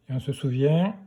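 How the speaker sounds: usual speaking voice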